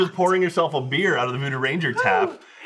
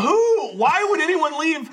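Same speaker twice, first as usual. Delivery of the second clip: baffled outrage; 8 semitones higher, slower than usual. Retold or imitated. Finding a half-eaten kolache.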